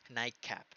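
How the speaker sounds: "Nightcap" with T becoming K; assimilated T. Shown as a demonstration. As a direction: run "Nightcap" together as "naik-cap". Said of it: "La T pasa a una K". ("Nightcap" sin T, suena "naik-cap")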